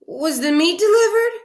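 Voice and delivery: high, loud anxious question; Mrs Rogers voice. Acted. Mrs Rogers asks Amelia about the meat, high and anxious. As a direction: ask it in a high worried voice.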